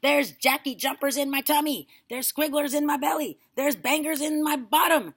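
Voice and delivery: grandma yelling about her belly; indignant yelling. A narrator's reading. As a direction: yell her complaints. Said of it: "she yelled".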